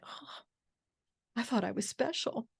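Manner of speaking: soft, let-down sigh of a line; deflated. Retold or imitated. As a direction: let the hope leak out. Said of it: "I was kind of deflated".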